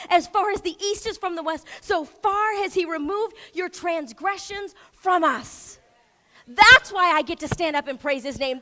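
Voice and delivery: loud, ringing preacher's proclamation; loud sermon climax; full-force preaching. Acted. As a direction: preach it out to the whole room. Preaching climax, crowd praise.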